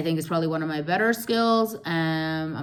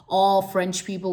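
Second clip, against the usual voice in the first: ordinary delivery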